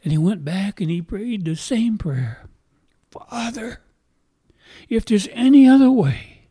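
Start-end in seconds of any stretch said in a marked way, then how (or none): 3.1-3.8 whispered call to the Father. Acted. Jesus praying alone to his Father, whispered. 4.9-6.4 louder, higher anguished plea; pleading cry. Acted. Jesus begging his Father in the garden, loud pleading cry.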